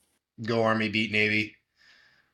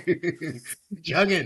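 frat bro; 8 semitones higher, much slower than usual. Real, not acted. Cheering the chug.

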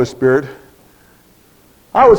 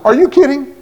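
higher, incredulous Are you kidding?; stunned disbelief; 7 semitones higher than usual. Retold or imitated. Preacher told to quit teaching Sunday school, incredulous. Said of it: "I really did".

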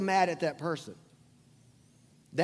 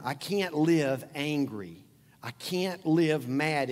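slow emphatic; much slower than usual. Real, not acted. Slow emphatic sermon point.

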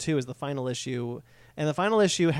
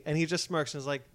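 ordinary talk

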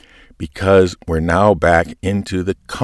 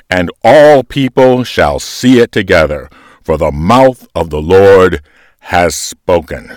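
louder, ringing proclamation with each word punched; bold proclamation; 8 dB louder than usual. Shown as a demonstration. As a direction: proclaim it boldly, like a herald. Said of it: "as a bold proclamation".

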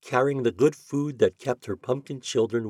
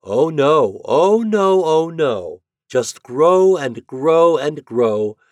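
high, loud singsong no; merry teasing refusal; 6 semitones higher, 8 dB louder, much slower than usual. A narrator's reading. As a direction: tease them merrily, stretching the words. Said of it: "laughed together and chuckled".

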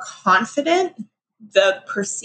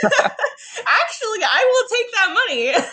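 high and laughing through it; slower than usual. Real, not acted. Joking she'd take the money, laughing. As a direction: joke it out while still laughing.

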